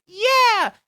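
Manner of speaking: high, drawn-out yeah; excited crowd cheer. Acted. Fans answering the nugget offer, high excited cheer.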